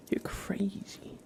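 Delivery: normal talking voice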